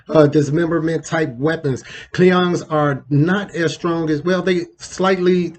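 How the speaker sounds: ordinary talk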